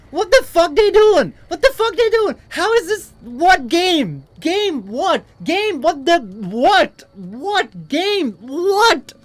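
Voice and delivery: high, sputtering disbelief; baffled outburst. Real, not acted. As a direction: sputter in total bewilderment. Baffled outburst at game enemies.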